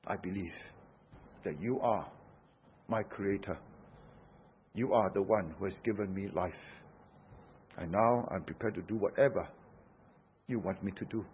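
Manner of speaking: low, soft, reverent surrender; humble confession. Acted. Preacher voices Thomas pledging himself to his creator, humble. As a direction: confess it softly, pitched well down.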